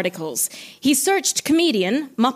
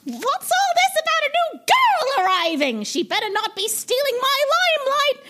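very high, put-on diva squeal; badly faked puppet diva, pitched way up; bad Miss Piggy voice; 11 semitones higher than usual. Jealous diva objects to a new girl arriving, indignant squeal. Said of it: "I can't do the voice".

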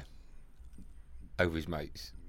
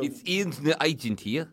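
higher-pitched mimicked South African phone greeting; 4 semitones higher than usual. Agent introducing himself on the phone, South African accent.

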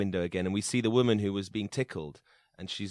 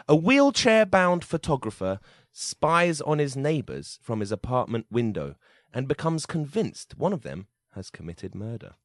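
dramatic synopsis read; 5 semitones higher, 6 dB louder than usual. Acted.

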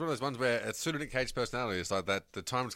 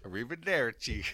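bad Italian accent. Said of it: "can't do fucking accents".

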